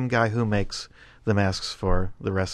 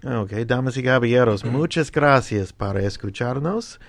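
stage introduction in Spanish; 3 semitones higher than usual. Acted. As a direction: introduce the band like a show announcer. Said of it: "we should ask [name] to introduce it".

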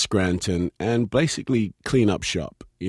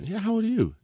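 much higher mocking repeat; mocking echo; faster than usual. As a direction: parrot the line back to embarrass. [name] mockingly repeats the line.

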